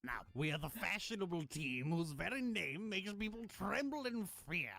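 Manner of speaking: a put-on French-ish accent that drifts German; villain intro in a wobbly fake French accent. Game dub villain boasting to the player, bad French accent. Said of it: "What the fuck kind of accent is that?".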